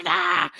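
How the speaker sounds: short high-pitched scream; high "ah!" yelled in mock battle; retold scream. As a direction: scream back at the kids. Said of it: "I scream".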